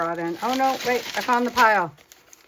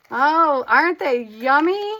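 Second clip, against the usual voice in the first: higher, louder coo of delight; delighted gushing; 5 semitones higher, 7 dB louder, slower than usual. Real, not acted. Crafter admiring her pieces to viewers, delighted gush. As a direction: gush over something gorgeous.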